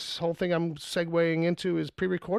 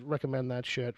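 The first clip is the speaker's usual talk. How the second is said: ordinary talk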